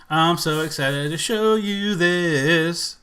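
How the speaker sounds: slow, stretched-out anticipation; playful sustained build-up to a reveal; drawn-out singsong. Pretend. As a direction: stretch each word, holding the last. Repeats it in singsong before clip.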